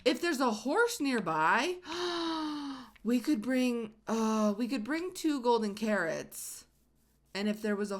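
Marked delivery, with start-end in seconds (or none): 0.0-6.7 excited. Real, not acted. Sudden excited idea.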